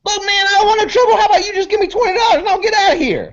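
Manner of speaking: high, rapid-fire pleading; frantic high-pitched haggling; bargaining crackhead. Acted. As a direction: rattle it off in a high voice.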